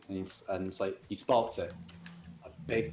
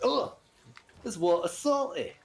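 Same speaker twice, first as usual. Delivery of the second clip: high, dismayed English warthog; disgusted warthog Len; 8 semitones higher than usual. Acted. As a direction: recoil at the salty taste, hammed up. Warthog tastes salt water.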